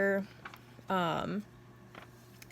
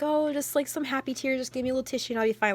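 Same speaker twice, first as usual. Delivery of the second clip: mock subtle crying; 6 semitones higher, 3 dB louder, faster than usual. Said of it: "subtle crying".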